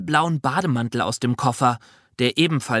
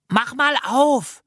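put-on high, slow little-girl call; excited child calling out; 10 semitones higher, slower than usual. A narrator's reading. Jana urging the narrator to open the little bag, excited call. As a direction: call it out high, stretching the last word. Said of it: "rief Jana".

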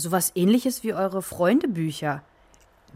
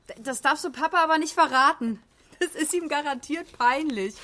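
high, giddy and delighted; gushing excitement; 9 semitones higher than usual. Acted. Mother shows daughter the old album, gushing. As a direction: squeal over a sweet old keepsake.

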